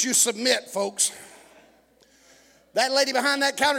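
normal talking voice

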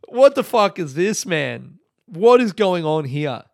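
loud, incredulous what-the-fuck; baffled outburst. Retold or imitated. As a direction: shout it out in disbelief at the empty room. Retelling his baffled reaction onstage.

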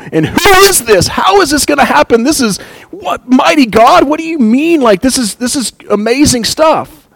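very high, astonished questioning; excited amazement. Acted. People carefully reading the prophecy, excited and amazed. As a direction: play readers amazed by what they read. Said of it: "the people are excited and going".

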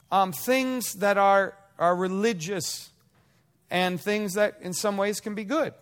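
usual speaking voice

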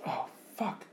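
frustrated breathy whisper; whispered curse. Real, not acted. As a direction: whisper it under your breath. Forgets the movie title.

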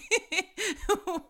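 short high laugh; giggling at herself; high burst of laughter. Real, not acted. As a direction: let a laugh break out. Laughs at her own meal.

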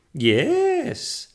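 very high witch voice; drawn-out, high-pitched witch's yes; witch's glad yes. A narrator's reading. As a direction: call out agreement as the witch. Witch welcomes the frog.

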